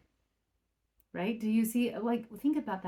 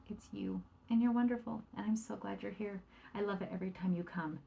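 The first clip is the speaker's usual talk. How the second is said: soft, delighted greeting; 7 dB quieter than usual. Retold or imitated. Grandparent greeting a visitor.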